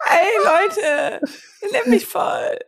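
high mocking whine; imitating whiny voice. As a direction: mimic him in a high whine. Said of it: "Oh ja, das nervt mich".

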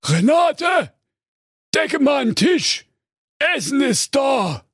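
a monster hollering for dinner; fearsome ogre shout. Acted. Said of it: "eine furchterregende Stimme rief".

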